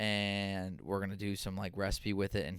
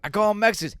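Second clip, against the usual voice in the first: much higher, louder grandpa voice; stubborn old man, pitched up; imitating grandpa; 11 semitones higher, 10 dB louder, much faster than usual. Grandson quotes grandpa's label, high loud imitation. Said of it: "He goes".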